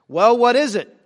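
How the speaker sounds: loud, high booming voice from above. Acted. God answers the praying bishop at the altar, booming. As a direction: boom it out, suddenly and loudly. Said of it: "there's this booming voice that says".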